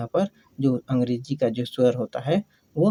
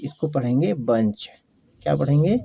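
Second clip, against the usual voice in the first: usual speaking voice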